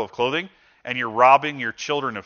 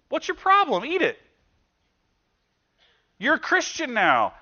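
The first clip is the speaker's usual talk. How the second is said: much higher, mocking sneer at the newcomers; mocking scold; 12 semitones higher than usual. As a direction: squeak it out like a scornful churchgoer. Church mocks Indian believers' beef rule.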